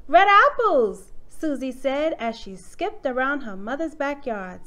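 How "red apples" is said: high, loud cry of delight; excited exclamation. A narrator's reading. Susie spots things while skipping in the yard, excited. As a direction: call it out like a thrilled little girl. Child's delighted find in story.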